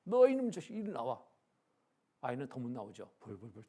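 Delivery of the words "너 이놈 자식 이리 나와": sudden furious shout, much higher and louder; angry father yell. Retold or imitated. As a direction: bellow at the hiding son. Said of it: "갑자기 화가 났어요".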